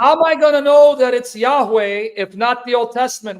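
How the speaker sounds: loud, insistent questioning; heated demand. Real, not acted. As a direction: press the question forcefully, raising your voice. Heated debate, pressing for an answer.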